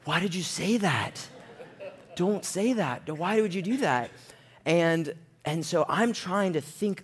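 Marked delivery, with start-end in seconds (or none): 0.0-4.1 higher-pitched mock reproach; dismayed reproach. Guys looking at him after the slip, dismayed imitated reproach. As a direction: plead in disbelief, pitch raised. Guys' silent reproach voiced.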